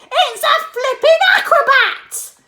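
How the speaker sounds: put-on high loud old woman; Grandma shouting. A narrator's reading. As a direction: cheer the hen as Grandma. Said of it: "Grandma shouted".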